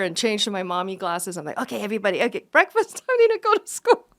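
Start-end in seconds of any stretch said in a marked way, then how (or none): 1.6-4.1 laughing mom voice. Retold or imitated. Mom mode after flight.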